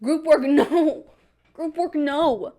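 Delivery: slow, laughing, drawn-out whine of Group work, no; whiny refusal. Retold or imitated. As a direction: stretch it out like a sulking kid. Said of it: "And I'm like".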